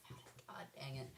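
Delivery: whispered muttered curse; whispering. Real, not acted. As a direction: whisper the curse under breath. Frustrated aside.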